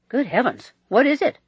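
high, loud, urgent question; alarmed. A narrator's reading. Walter fearing bad news from his cousin, alarmed. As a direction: jump in with rising alarm. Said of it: "clearly alarmed".

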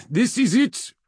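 high, loud military captain's call; rallying commander. A narrator's reading. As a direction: address the troops with urgency, pitched high and loud. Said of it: "did not raise her voice".

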